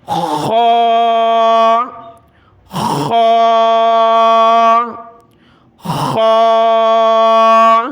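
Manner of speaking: "خا" with a loud throat-clearing scrape, vowel rounded and held; thick, throat-clearing. Shown as a demonstration. Teacher demonstrates a heavy letter, "خا" scraped from throat. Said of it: "جس طرح ہم گلا صاف کرتے ہیں".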